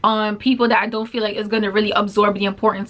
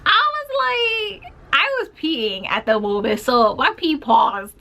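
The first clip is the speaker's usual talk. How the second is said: shocked; 6 semitones higher than usual. Real, not acted. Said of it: "because I was just shocked".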